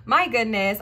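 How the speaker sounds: talking through hiccups; hiccuping, voice jumping high. Real, not acted. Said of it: "I got the hiccups".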